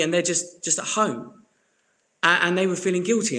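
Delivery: ordinary talk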